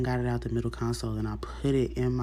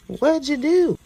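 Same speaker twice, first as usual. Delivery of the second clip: high, loud question; incredulous officer; 10 dB louder, much slower than usual. Acted. Officer hearing of criminal mischief charge, incredulous. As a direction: pitch it way up in disbelief.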